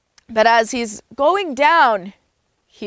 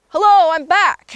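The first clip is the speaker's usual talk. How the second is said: higher and louder, bursting with joy; excited homecoming; 8 semitones higher, 5 dB louder than usual. Acted. Young beaver back home greets his father, excited. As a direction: call out happily on arriving home.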